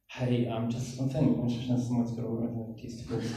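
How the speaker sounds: low, soft, hurried confidential murmur; discreet aside. Retold or imitated. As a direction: reenact a sheepish private word to the speaker. Sneaking up to the speaker privately.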